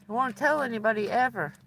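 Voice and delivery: normal talking voice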